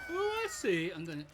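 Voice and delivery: usual speaking voice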